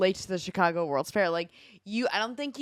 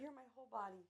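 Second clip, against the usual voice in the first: holding down a pet; 4 semitones higher, 19 dB quieter, faster than usual. Real, not acted. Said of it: "She's wrestling a cat".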